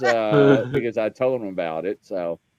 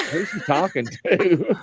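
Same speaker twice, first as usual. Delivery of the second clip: slow, higher mock puzzled mutter; puzzled aside; 5 semitones higher than usual. Retelling his confused reaction to the lady, slow puzzled mock aside. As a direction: wonder aloud who she means, slowly. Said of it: "And I'm like".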